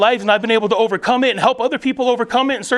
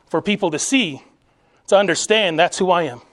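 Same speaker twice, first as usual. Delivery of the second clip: ordinary talk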